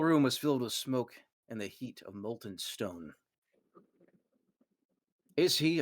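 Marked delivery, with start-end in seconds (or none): 5.4-5.8 high, louder broken-off question; a guard gasping out a half question; startled question. Acted. Guard to the room, startled and cut off.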